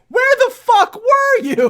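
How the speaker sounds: high, loud, slow furious shout with a laugh; mock-furious bellow; angry yell. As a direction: demand an answer, hammed up. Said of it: "go up to people and go".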